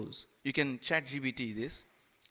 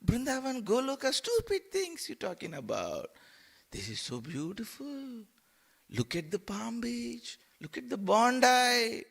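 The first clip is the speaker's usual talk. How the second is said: much higher, dismissive temptress voice; scolding Maya; 9 semitones higher than usual. Acted. Maya dismissing Brindavan and luring to Bondi, high mocking squeal. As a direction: brush him off in a high squeal, then lure.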